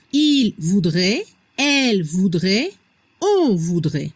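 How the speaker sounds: "il voudrait", "elle voudrait", "on voudrait" low and separated, ending in E; pronunciation model. Shown as a demonstration. Teacher showing learners third-person conditional, careful low "il voudrait", "elle voudrait", "on voudrait". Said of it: "La pronunciación".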